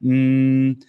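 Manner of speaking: steady closed-mouth hum; sustained hum; humming demo. Shown as a demonstration. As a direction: hold a long mm through the nose. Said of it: "put the emphasis on the hum".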